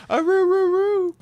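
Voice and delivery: high-pitched "roo, roo" hoot; drawn-out mock howl; playful howl. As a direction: howl it like a cartoon dog. Playful howl while bantering.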